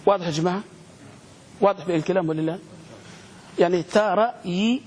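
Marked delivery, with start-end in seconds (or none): 3.8-4.8 "ترى ي-" slowly, long "yii" held; sounded out. Shown as a demonstration. Teacher models the word for class, slowly in parts. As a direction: split "ترى ي-" into ta-raa, yii. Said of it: "كيف تنطقها؟".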